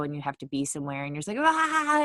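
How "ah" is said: high, loud frustrated "aah"; short acted scream of road rage; mock scream. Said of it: "you're screaming".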